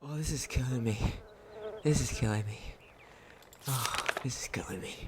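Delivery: low, soft pained murmur, fading to a whisper; quiet real despair sinking into a whisper; overwhelmed. Real, not acted. Overcome by the sad story.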